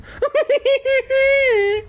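squeaky acted tittering, very high; high-pitched giggle. A narrator's reading. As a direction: impish string of high hee-hees. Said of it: "I heard giggling".

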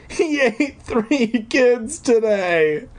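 high, slow, shaken voice; trembling fear. Acted. Deirdre telling Wendy of the eaten kids, high and slow. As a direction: say it barely holding together.

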